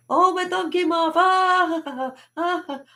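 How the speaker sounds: high, hammed-up agony; mock pained wailing. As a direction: fake a thumb injury with a high wail. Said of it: "How can I show you?".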